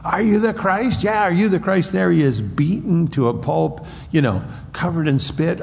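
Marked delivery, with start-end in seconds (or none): 0.0-1.9 high jeering taunt; mock yelling crowd. Seventy men yelling at the beaten Jesus. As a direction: jeer at him like a mocking crowd. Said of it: "yelling at him".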